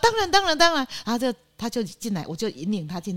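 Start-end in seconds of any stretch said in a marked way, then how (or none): 0.0-0.9 much higher eager agreement; eager yes. Retold or imitated. Welcoming her first stranger to the guesthouse, eager and high. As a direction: gush your consent to the visitor.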